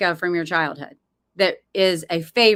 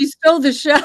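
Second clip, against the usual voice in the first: laughing; 7 semitones higher, 4 dB louder, faster than usual. Real, not acted. Laughing reply to the story.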